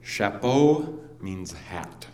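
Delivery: slow, deliberate explaining; slow mock lesson; slow teaching. Shown as a demonstration. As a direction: spell it out slowly like a lesson. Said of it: "here's an example".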